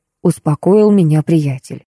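ordinary delivery, nothing marked

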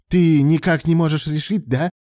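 high, loud accusation; angry challenge. Acted. Alan confronts his indecisive lover, angry. Lovers' quarrel over a rival.

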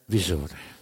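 ordinary delivery, nothing marked

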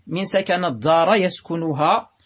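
ordinary talk

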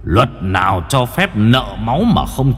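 low, loud vengeful spirit, rising at the end; growing defiance from a wronged ghost; menacing ghost leader. Acted. (giọng quỷ đe dọa, thách thức)

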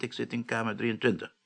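normal talking voice

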